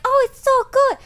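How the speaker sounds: high, loud gushing mock voice; mocking fans. Vlogger mocking gushing viewers, high squealing mock voice. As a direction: squeal it like a sappy fan. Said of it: "everyone's like".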